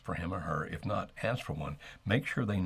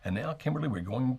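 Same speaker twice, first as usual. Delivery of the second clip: a showman's hand-off to the co-host; bright announcement; 7 semitones higher, 3 dB louder than usual. Real, not acted. Host turning to his co-host for the next call, pitched up high. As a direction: lift the voice high to cue the next caller.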